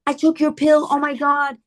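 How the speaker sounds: high, panicky plea to a daughter; reenacted mum in a panic; freaking out. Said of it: "I was literally freaking out".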